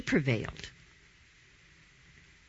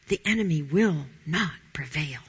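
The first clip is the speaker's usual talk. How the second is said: intense whisper; 2 semitones lower than usual. Shown as a demonstration. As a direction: lean in and whisper the promise. Emphatic sermon reminder.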